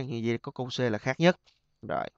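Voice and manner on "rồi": usual speaking voice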